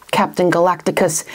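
ordinary delivery, nothing marked

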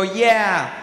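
open, American "Yeah"; American accent. Shown as a demonstration. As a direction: imitate an American saying "Yeah". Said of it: "in America they'll go".

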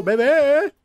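loud high call across the house. Real, not acted. Calling the crying baby.

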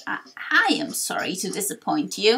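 low, halting elder's apology; lightly voiced old man, regretful; apologetic old sage. Torkoal apologises.

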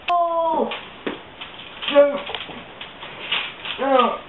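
high panicked yelps; panicked cries. Acted. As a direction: fend off an attacker in panic. Fighting off the bird.

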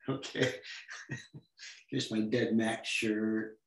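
normal talking voice

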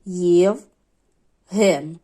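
«Євген» split in two, with a long pause between «Єв» and «ген»; по складах. Shown as a demonstration. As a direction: count out the two syllables of «Євген». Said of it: "скільки тут складів". (вимовити «Євген» по складах, з довгою паузою)

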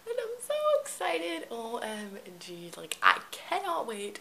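excited squeal. Real, not acted. Squealing with excitement over new editor.

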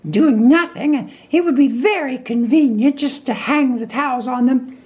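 high-pitched, polite, practical chatter; fussy Rabbit. Acted. Rabbit asking stuck Pooh a favour, high and brisk. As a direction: prattle on in a high character voice.

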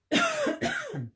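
loud throat-clearing cough; real cough. Real, not acted. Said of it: "Frog in my throat".

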